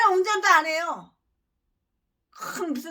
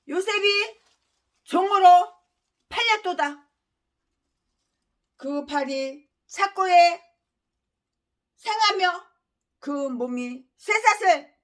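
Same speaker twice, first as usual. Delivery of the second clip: preaching; 2 semitones higher than usual. Acted. Long sermon passage.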